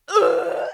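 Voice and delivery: high, loud "ugh" of revulsion; short loud retch of disgust; loud disgusted groan. Real, not acted. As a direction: groan as if revolted. Disgusted at the quoted line.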